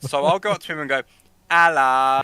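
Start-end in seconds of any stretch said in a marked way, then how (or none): 1.5-2.2 a loud, exaggerated London Hello; exaggerated London accent. Teasing his colleague with an exaggerated London accent. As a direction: lay the London accent on thick. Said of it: "in a more exaggerated London accent".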